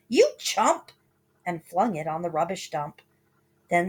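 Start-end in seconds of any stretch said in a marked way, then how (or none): 0.1-0.9 high, loud scornful yell. Acted. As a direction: yell the insult. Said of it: "she yelled".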